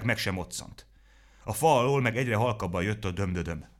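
plain reading voice

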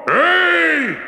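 high, booming furious giant yell; giant shouts. Acted. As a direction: bellow after the thief.

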